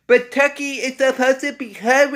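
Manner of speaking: high-pitched mock whine of objectors; mocking the complainers. Film fan voicing everybody objecting to Seed of Chucky, mocking. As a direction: put on a high, silly voice for the critics. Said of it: "Now, everybody's like".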